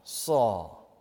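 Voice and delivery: Jesus calling Saul again, tenderly; Jesus, tender repeat. Retold or imitated. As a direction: let the second Saul fall gently, like a parent. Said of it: "And Jesus says".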